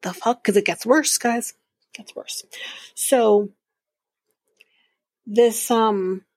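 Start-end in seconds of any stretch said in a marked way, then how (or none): 1.9-2.4 hushed, voiceless whisper; whispered aside. Real, not acted. Vlogger warning viewers the story gets worse, whispered.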